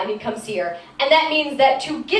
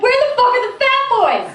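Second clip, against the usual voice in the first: mock outrage; 10 semitones higher than usual. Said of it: "I was like".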